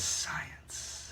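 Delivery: grinning whispered science; smug whisper. Acted. Doctor grinning after his experiment, whispered. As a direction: whisper it with a grin. Doc grins and says.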